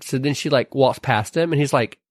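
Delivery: ordinary delivery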